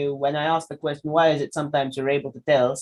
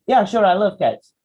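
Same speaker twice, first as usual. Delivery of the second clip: sarcastic; 6 semitones higher, 6 dB louder than usual. Shown as a demonstration. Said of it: "the sarcastic".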